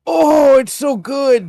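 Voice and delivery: loud, high fan gushing; gushing delight. Retold or imitated. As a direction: gush like a thrilled fan. Raving about the villain's voice.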